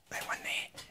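breathy whisper aside. Real, not acted. Pointing out the box to viewers, whispered. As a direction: whisper it like a secret.

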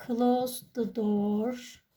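slow, careful teacher read of "Close the door"; slow model phrase. Shown as a demonstration. Teacher reading the phrase slowly to pupils. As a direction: spell it out slowly for the class. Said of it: "teacher models the English phrase".

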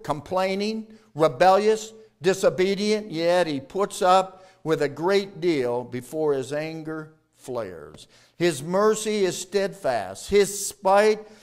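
ordinary delivery

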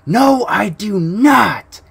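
high, very slow mocking whine of a denial; a hammed-up streamer denying it all. Stretched high whine, the streamer denying his girlfriend's claim. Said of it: "he will go".

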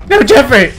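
loud, high cry for a lost companion; high, loud protest; distressed shout. Real, not acted. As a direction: shout out in dismay. Calling for lost game companion.

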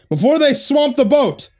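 high, urgent shout; captain's yell. A narrator's reading. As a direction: yell to hurry them. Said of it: "the captain yelled".

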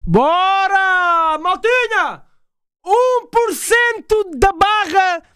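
rally the chat like it's a big win; hyped celebration. Real, not acted. Streamer cheering the first donation percent to his chat, high and stretched.

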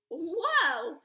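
loud, surprised whoa; excited exclaim. Acted. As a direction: burst in amazed. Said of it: "he exclaimed".

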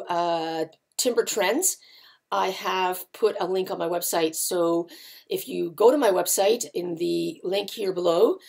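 usual speaking voice

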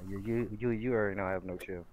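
ordinary delivery

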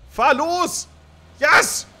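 triumphant high shout; being chased. Real, not acted. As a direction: scream high and loud in relief. Escapes by car, triumphant.

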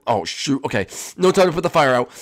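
normal talking voice

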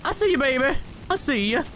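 high, cracking singsong call; playful high squeak that cracks. Real, not acted. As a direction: let the voice crack upward as you call out. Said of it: "Unnecessary voice crack".

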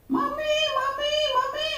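put-on high, soft pleading child's wail; child crying out. Beaten child crying for mother. (anak dikurung merintih memanggil Mami dengan suara tinggi)

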